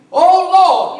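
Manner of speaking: high, drawn-out preacher's cry; retold shout. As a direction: call out as the blind men shouting. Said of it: "they shouted out anyway".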